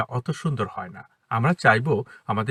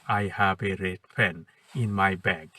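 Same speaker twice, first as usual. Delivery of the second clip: every word given equal weight, very slow; flat word-by-word reading; translation style; 5 semitones lower, 3 dB quieter, much slower than usual. Shown as a demonstration. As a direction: read "I have a red pen in my bag" word by word, evenly stressed. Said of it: "এটা translation".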